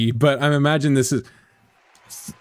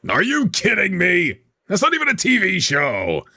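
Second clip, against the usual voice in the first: mildly outraged, high-pitched objection; indignant protest; 5 semitones higher than usual. Acted. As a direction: protest in higher, affronted disbelief. Host protests rival podcast.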